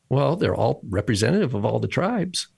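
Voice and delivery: ordinary talk, nothing marked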